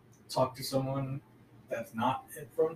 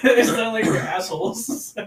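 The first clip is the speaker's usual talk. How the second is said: loud, high, laughing protest; 10 semitones higher, 13 dB louder than usual. Real, not acted. Texan defending Texans to a friend, laughing loudly. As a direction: protest through real laughter, voice raised.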